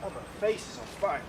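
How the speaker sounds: strained, breathless gasp; struggling for air; choking on tear gas. Real, not acted. Just walked through pepper gas.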